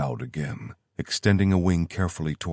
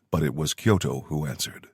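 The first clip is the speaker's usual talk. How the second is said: usual speaking voice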